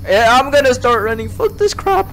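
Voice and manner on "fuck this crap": panicked curse. Real, not acted. Starts running away.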